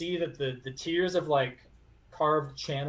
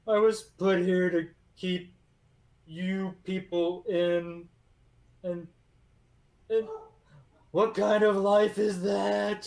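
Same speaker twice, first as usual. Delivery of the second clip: weeping golem; 6 semitones higher, 5 dB louder than usual. Acted. Golem laments, crying.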